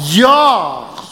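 "Y'all" said as one long falling syllable, "yawl"; drawled y'all. Shown as a demonstration. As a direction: stretch "Y'all" into a Southern "yawl". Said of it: "Wha-, what's the word?".

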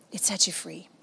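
hushed echo of the line. Acted. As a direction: let the repeat fade down. Preacher repeats key line softer.

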